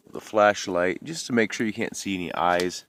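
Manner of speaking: ordinary talk, nothing marked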